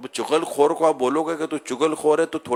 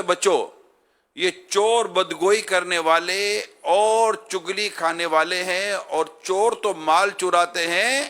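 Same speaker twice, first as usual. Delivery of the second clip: slow, raised and emphatic preaching; 4 semitones higher, 4 dB louder, slower than usual. Acted. Preacher quoting the saints, slow emphatic preaching. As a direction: lean on each phrase like a sermon.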